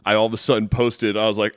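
usual speaking voice

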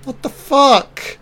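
high, slow, stunned what the fuck; shocked disbelief. Real, not acted. Reacting to a shocking film moment, high and stunned. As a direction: drag it out in high-pitched disbelief.

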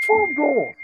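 high, emphatic disbelief; incredulous scoff. Real, not acted. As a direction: throw it out high, as if it's absurd. Scoffing at a meagre goal tally.

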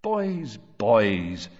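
absent-minded appeal, dropping in pitch on the second word; vague. A narrator's reading. Father vaguely hushing his sons while thinking. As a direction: say it distractedly. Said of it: "said Mister Weasley vaguely".

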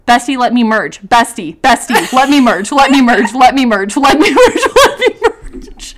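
repeated pleading, rising to a laughing wail; retold pleading in traffic. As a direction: beg the truck to let you in. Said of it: "the next part of my note says".

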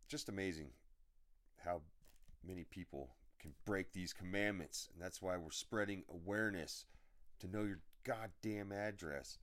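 ordinary delivery

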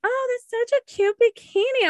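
high-pitched imitation of cooing admirers; mock gushing. Mimicking people who praise skimpy bikinis, mock gushing. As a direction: squeal it high, mocking the compliment.

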